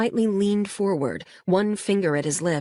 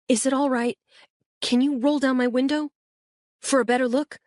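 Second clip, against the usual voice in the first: lightly voiced boy, eager, pitched higher; a kid pointing out the house, keen; Luis, excited boy; 8 semitones higher than usual. A narrator's reading. Boy in the back seat guiding the officer, eager.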